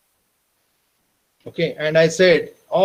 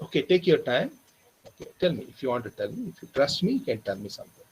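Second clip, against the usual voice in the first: soft, lower, patient reassurance; reassuring coax; 4 semitones lower, 9 dB quieter than usual. Retold or imitated. Teacher coaxing the weeping student to open up, soft and reassuring. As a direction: gently invite him to trust you.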